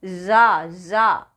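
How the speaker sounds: "जा" as za, held long; buzzed z sound. Shown as a demonstration. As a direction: slowly buzz za for "जा", twice. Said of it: "ये है जा". ("जा" को खींचकर ज़ा)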